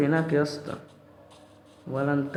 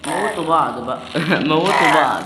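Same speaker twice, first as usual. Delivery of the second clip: loud gleeful yelling with a laugh; gleeful shout; 2 semitones higher, 9 dB louder than usual. Real, not acted. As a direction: cheer them on loudly, laughing. Watching mobs fight each other. (صياح فرحان مع ضحكة)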